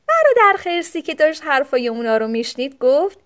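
usual speaking voice

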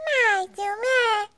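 high, drawn-out eager sure; Suzy agreeing to see the necklace. A narrator's reading. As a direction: say it slowly and gladly. Suzy voiced in story.

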